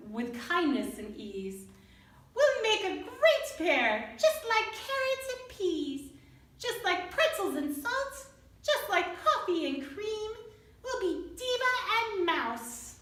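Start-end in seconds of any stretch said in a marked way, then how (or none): 2.3-13.0 cheerful mouse. Acted. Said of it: "cheered Fernando".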